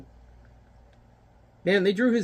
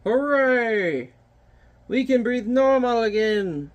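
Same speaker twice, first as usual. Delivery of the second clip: high cheerful hooray, pitched up; cheering townsfolk; 7 semitones higher, slower than usual. Acted. Townsfolk cheering the giant, high excited voice. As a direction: celebrate like a relieved villager. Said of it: "and they're like".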